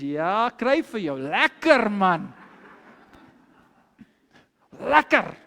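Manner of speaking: slow, drawn-out mocking relish; snickering gloat. Man on the horse snickers at his humiliated enemy, gloating. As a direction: gloat and stretch the words out. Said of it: "he's snickering away and he says".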